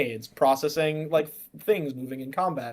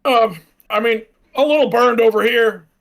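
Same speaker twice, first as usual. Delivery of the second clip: disguised voice; 6 semitones higher, 9 dB louder than usual. Acted. Disguised voice calling back to stranger.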